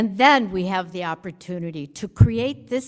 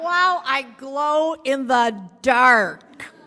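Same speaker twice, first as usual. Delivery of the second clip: wry wow; 6 semitones higher, 6 dB louder, slower than usual. Retold or imitated. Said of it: "Yeah, I was like".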